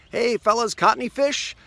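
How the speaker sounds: high, loud call across the water. Retold or imitated. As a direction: hail the boat from the shore. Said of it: "he calls out to Peter".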